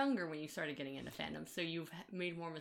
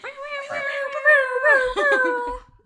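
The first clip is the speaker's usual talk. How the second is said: high shrieking laugh; 19 dB louder than usual. Real, not acted. Laughs after shock jock intro.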